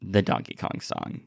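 slow, quiet, reluctant reply; hesitant retold answer. Kid naming the part he disliked, hesitant and slow. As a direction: drag it out softly after some thought. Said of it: "took a very long minute and said".

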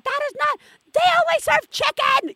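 put-on very high child's protest; complaining kid. Children starting up at mom, high-pitched mock complaint. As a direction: squeal it like an upset kid.

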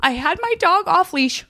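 high mocking voice of the dog owner; mimicking the woman. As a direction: pitch up to mock her excuse. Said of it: "She was like".

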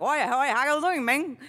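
Miami Cuban Spanglish, lightly voiced; light Cuban Spanglish lilt; Cuban accent. Shown as a demonstration. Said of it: "I speak like a, a Cuban".

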